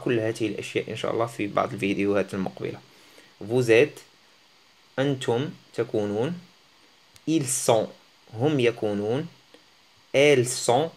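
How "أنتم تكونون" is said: ordinary talk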